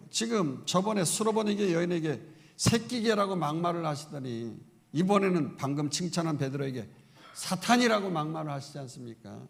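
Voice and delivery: ordinary talk, nothing marked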